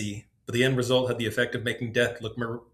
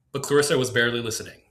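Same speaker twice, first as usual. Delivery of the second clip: ordinary delivery, nothing marked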